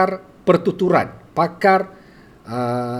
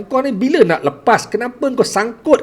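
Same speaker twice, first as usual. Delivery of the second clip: higher, exasperated questioning; pitched-up badgering questions; impatient listeners; 7 semitones higher than usual. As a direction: imitate impatient friends pressing a stammerer. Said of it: "Dia orang rasa macam".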